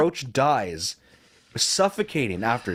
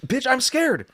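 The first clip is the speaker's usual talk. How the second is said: mock fear; 11 semitones higher, 3 dB louder than usual. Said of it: "And, and I'm like".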